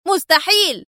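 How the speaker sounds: "مستحيل!" as an enraged high yell; furious scream. Acted. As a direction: hurl "مستحيل!" as he lunges for the gun. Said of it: "صارخا".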